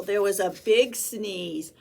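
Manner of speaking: ordinary delivery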